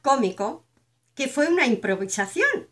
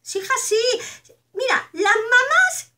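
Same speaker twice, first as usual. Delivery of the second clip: higher, slow, whining mock cry; mock lament; 8 semitones higher, slower than usual. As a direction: wail it as an overdone complaint. Mock lament about mothers.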